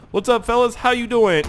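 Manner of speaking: loud, high, cocky hail; playful shouted hello; calling out a greeting. Pretend. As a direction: holler cheerily at the fellas. Hails enemies from vehicle.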